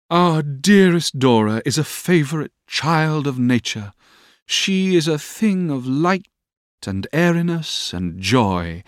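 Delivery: high, slow, lofty pronouncements; sentimental, measured lady's voice; sententious Miss Mills. Acted. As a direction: draw out each phrase with sweeping high pitch. Miss Mills speaking in the story.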